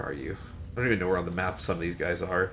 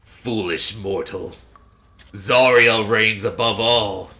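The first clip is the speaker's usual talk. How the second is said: slow, booming devil voice; menacing fiend proclaiming slowly; dark lord; 6 dB louder, slower than usual. Acted. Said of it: "the obvious devil comes in and says".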